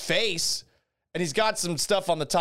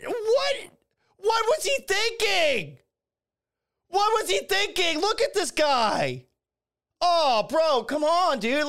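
much higher, disbelieving cries at the screen; incredulous outburst; slower than usual. Real, not acted. Host watching the slap video with listeners, real shocked disbelief.

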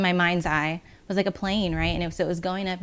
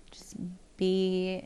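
just be whispered with no voice. Acted. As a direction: breathe the words just be as a whisper. Repeating a calm motto.

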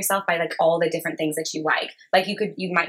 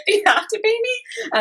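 very high, hammed-up timid question; sheepish mock reply. Beginner photographer to a would-be client, sheepish. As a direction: squeak it out as if embarrassed to charge. Acting out shy reply about payment.

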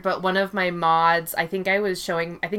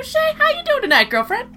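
high, fast, gushing hello; excited greeting; 4 dB louder than usual. Real, not acted. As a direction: greet your bestie with a squeal. Greeting co-host.

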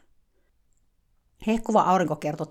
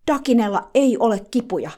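higher, louder outburst; exclaiming; 5 semitones higher, 7 dB louder than usual. Retold or imitated. Eager helper cutting the narrator off, exclaiming. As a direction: blurt it out in protest. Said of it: "hän huudahti".

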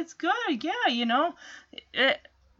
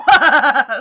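loud, goofy guffaw imitation; big put-on taunting laugh; weird mocking laugh; 11 dB louder than usual. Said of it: "this laugh that he's got, like, it's weird".

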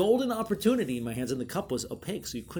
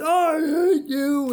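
loud, high, slow playful "hate you"; mock outrage; 15 dB louder, much slower than usual. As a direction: yell it half laughing. Coworker after the prank.

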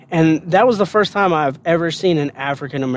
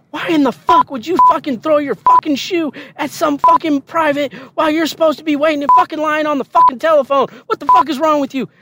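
high-pitched, rapid-fire tirade; angry drill sergeant yelling; 11 semitones higher, 3 dB louder than usual. Retold or imitated. Drill sergeant chewing out recruit for throwing a shoe, angry yelling. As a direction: berate them at speed, punching every curse. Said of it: "because she yelled at me so fucking much".

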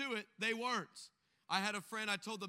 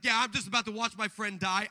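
said through a laugh; chuckling while imitating the guy; amused imitation of a stoned guy; 9 dB louder than usual.

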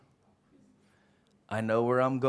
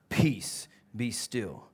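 calm command; 5 semitones lower, much slower than usual. Acted. Jesus calming the sea.

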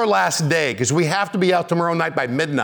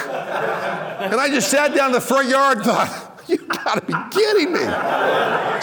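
laughing disbelief; 8 semitones higher, slower than usual. Retold or imitated.

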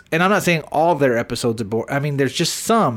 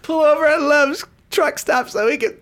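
much higher, hammed-up squeal; high mock plea; 13 semitones higher than usual. Riffing on the road trip line, high hammed-up plea. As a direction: ham it up like a desperate lover. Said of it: "repeating joke line".

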